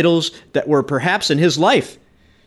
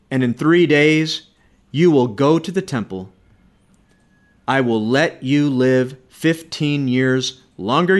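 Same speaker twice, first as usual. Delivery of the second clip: slow, measured pronouncement in a lower pitch; solemn divine promise; 4 semitones lower than usual. Acted. The Lord granting Hezekiah more years, slow solemn promise. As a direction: spell out the promise phrase by phrase. Preacher voices the Lord's promise.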